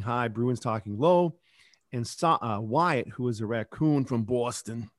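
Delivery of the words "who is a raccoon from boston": ordinary delivery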